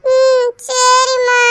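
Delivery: very slow, high, teary "okay"; tearful sick child. Acted. Feverish son weakly agreeing to take the pill. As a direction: sniffle out a weak yes. Sick boy answers mother.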